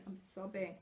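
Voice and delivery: usual speaking voice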